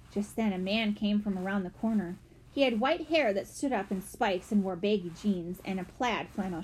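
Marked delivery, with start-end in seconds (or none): none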